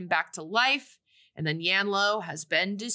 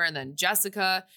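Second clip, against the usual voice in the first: usual speaking voice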